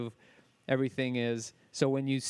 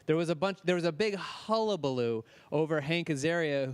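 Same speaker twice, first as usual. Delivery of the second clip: ordinary talk, nothing marked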